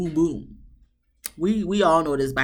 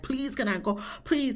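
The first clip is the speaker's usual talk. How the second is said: begging; 6 semitones higher, 6 dB quieter than usual. Retold or imitated. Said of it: "he's begging Michaela".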